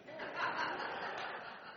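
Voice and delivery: a soft airy chuckle at his own joke; light breathy laugh. Real, not acted. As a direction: let a quiet amused laugh slip out.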